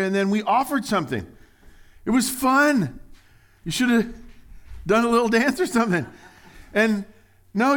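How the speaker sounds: loud, high, driving sermon voice; preaching at full force. Acted. Preacher pressing the crowd on their stubbornness, loud and high. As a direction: push each line to the back row.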